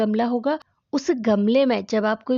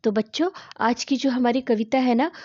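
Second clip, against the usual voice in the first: ordinary talk, nothing marked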